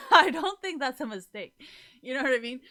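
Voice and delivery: loud, high-pitched laughing delivery; real laughter breaking into speech. Real, not acted. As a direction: burst out laughing through the words. Laughs at own absurd stabbing example.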